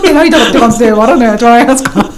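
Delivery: mock complaint through laughter; amused, laughing objection; laughing protest. Real, not acted. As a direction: protest while cracking up.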